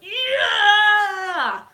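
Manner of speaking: high, loud whoop; crowd cheer. Retold or imitated. Audience erupting for Thor, roaring cheer. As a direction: scream like a whole cinema. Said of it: "everyone in the theater was like".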